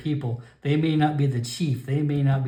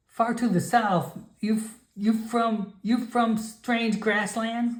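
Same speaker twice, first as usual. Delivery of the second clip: high, broken-English grandmother voice; stern old shaman warning off a stranger; old frog shaman; 9 semitones higher, slower than usual. Acted. Grandmother shaman warns the Nightkin away, high and stern.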